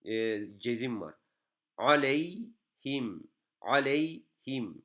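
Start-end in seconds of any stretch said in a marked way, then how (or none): 1.8-4.8 "Aleyhim" drawn out slowly, each syllable clear; slow model reading. Shown as a demonstration. Teacher reading slowly for students to copy. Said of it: "Cezimlere dikkat edelim".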